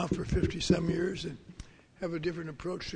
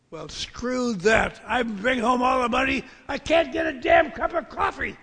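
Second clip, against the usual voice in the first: angry rant; 6 semitones higher, 9 dB louder than usual. Retold or imitated. Retold rant about missing coffee.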